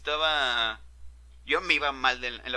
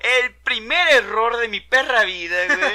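slow, much louder, comic outburst; loud exclamation; 7 semitones higher, 16 dB louder than usual. Real, not acted. Joking to his friend about the wedding, a loud slow outburst. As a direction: belt it out as a dramatic punchline.

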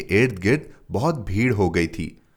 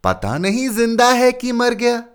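much higher, louder puzzled peon; peon asking; 5 dB louder than usual. A narrator's reading. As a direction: ask it, puzzled and loud. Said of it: "एक चपरासी ने पूछा".

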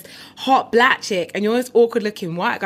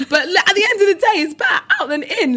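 laughing; 11 semitones higher, 3 dB louder than usual. Real, not acted. Laughing about burping.

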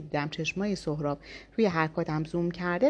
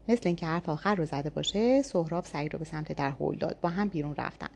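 plain reading voice

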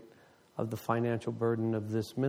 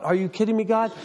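exasperated cry; 8 semitones higher, 6 dB louder than usual. Retold or imitated. Shouting at God on rock.

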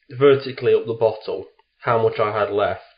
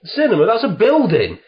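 high indignant protest; 10 semitones higher, 5 dB louder than usual. Real, not acted. Caught saying a banned word.

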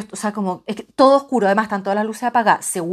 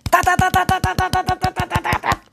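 high, quick "ta ta" imitating knocking; vocal knocking sound; 9 semitones higher than usual. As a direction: mimic urgent knocking. Imitating knocking on neighbour's door. (imitando golpes en la puerta)

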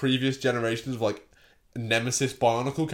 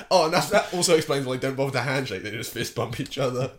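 ordinary delivery

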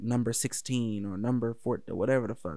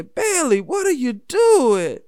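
much higher and louder, slow outraged yell; exasperated coach yell; 13 semitones higher, 12 dB louder, slower than usual. Retold or imitated. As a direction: bellow the kid's name across the field in disbelief. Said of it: "I remember hearing his voice say".

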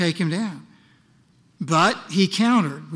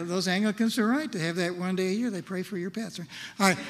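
usual speaking voice

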